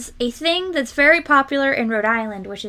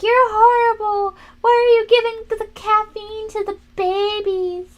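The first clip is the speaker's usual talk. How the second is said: much higher, louder, mock-horrified shriek; shocked people; 11 semitones higher, 5 dB louder than usual. Imitating horrified locals shrieking about babies' caffeine. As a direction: play the appalled stranger to the hilt. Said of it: "They're like".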